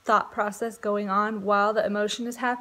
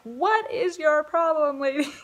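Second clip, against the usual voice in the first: mock husband freaking out; 5 semitones higher, 5 dB louder than usual. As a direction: play the fed-up husband, lightly hammed-up. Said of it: "I would probably freak out if I were him, be like".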